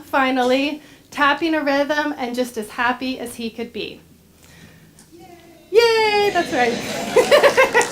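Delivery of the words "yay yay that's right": high, delighted cheer. Real, not acted. Cheering at the story's end.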